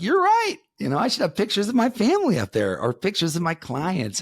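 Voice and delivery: high, meek giving in; sheepish admission. Retold or imitated. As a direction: concede like a caught-out kid. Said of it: "And I was just like".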